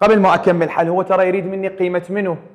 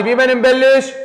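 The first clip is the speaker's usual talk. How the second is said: louder repeat; 7 semitones higher, slower than usual. Shown as a demonstration. Teacher to her class, demanding a loud answer, louder. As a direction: question repeated louder and higher. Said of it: "صوت عالي".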